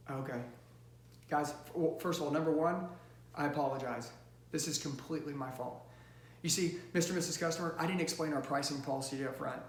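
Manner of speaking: low, soft, contrite apology; soothing, hushed salesman taking responsibility; empathy close. Shown as a demonstration. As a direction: apologize quietly to the customer, taking the blame. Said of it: "what does an empathy close look like? It's like this".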